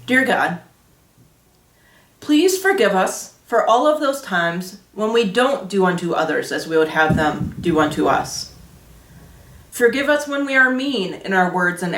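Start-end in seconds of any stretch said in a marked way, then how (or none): none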